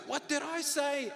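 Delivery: high, exasperated call over the class. Retold or imitated. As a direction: snap it at the noisy room. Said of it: "Then you raise your voice".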